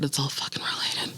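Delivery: hushed conspiratorial whisper, no voice. Real, not acted. Ominous music plays as she whispers to herself. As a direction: breathe it like a dark secret.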